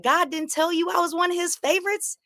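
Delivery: high, gloating taunt. Retold or imitated. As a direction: rub God's favour in his face from way up high. Taunting Corey in the street.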